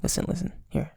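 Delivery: whispering around ice cubes; ASMR with ice in mouth. Real, not acted. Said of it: "I have ice in my mouth".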